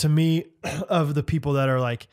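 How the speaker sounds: normal talking voice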